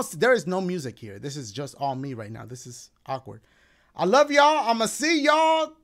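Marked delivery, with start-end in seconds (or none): 3.9-5.7 loud sign-off. Real, not acted.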